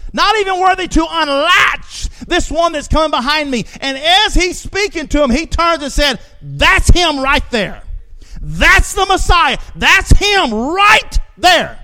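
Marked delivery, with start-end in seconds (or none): 0.1-2.1 preacher to congregation, slow rising shout; preaching shout. Real, not acted. Preacher building to climax. 6.4-11.8 slow, rising preacher's shout, louder and higher; John crying out to the crowd, slow loud shout; ringing shout. Acted. John points out the Messiah.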